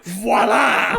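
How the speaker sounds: mock waiter flourish, called out loud; showy waiter's voila. Waiter producing a steak knife from his pocket, showy shout.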